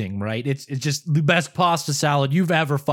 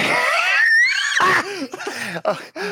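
long, high-pitched laugh; real laughter. Real, not acted. Storyteller after brother hangs up, real laughter.

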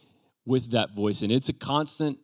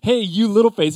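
higher and louder teasing nickname; playful teasing call; 10 semitones higher, 8 dB louder than usual. Retold or imitated. Preacher voices Jesus teasing disciples, loud playful call. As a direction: call them out fondly, loud. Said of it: "That's what he's calling them".

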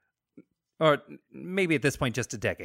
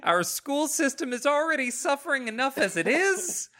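hammed-up concerned citizen rant, higher; playing a fretting taxpayer through laughter; mock pearl-clutching rant, breaking into giggles; mock outraged politician; 7 semitones higher than usual.

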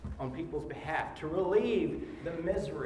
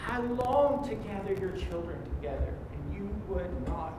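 high, tearful lament; ugly crying; 4 semitones higher than usual. Acted. Preacher voicing Jesus weeping over Jerusalem, tearful lament. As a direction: plead over the lost city through tears. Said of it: "snot running down his face crying".

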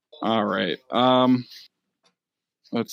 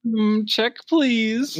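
high, mock-fancy "check, please"; mock posh check please; 10 semitones higher, much slower than usual. GM as Buscemi calling for the check, mock-fancy and high. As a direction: lift it up like a snooty diner.

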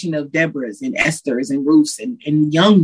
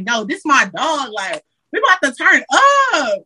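hyped friend; 7 semitones higher than usual. Retold or imitated. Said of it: "it's like".

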